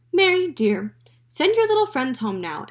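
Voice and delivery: mother calling Mary in. A narrator's reading. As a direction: call up to Mary.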